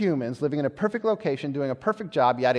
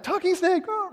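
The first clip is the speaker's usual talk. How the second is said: mock alarm; 12 semitones higher, 4 dB quieter than usual. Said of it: "I'm like".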